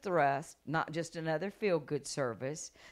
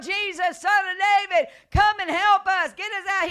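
loud, high desperate cry; 12 semitones higher, 12 dB louder than usual. Retold or imitated. Israelites crying out to God for help, shouting. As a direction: shout it like pleading for rescue. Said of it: "when they cried out".

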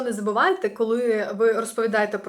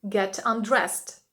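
slow model phrase; much slower than usual. Shown as a demonstration. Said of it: "teacher models phrase".

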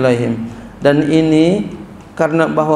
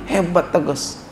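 ordinary delivery

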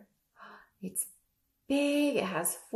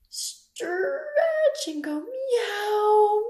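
drawn-out word into a high, loud meow; long playful cat stretch sound; 11 semitones higher, 6 dB louder, slower than usual. Shown as a demonstration. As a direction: draw it out as if stretching, then mew. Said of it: "Can you all stretch like a cat".

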